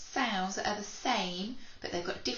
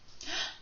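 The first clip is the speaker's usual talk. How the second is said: mock surprised gasp; 10 semitones higher, 5 dB quieter than usual. Card spotted.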